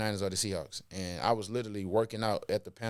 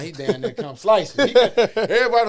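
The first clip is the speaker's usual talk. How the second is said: loud; 8 semitones higher, 13 dB louder than usual. Retold or imitated. Grim Reaper coach coming around.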